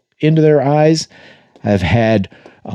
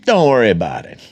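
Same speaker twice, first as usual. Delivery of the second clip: mock Iranian accent, starting high; breezy, badly faked Iranian accent; 3 semitones higher than usual. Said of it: "that's my best Iranian accent".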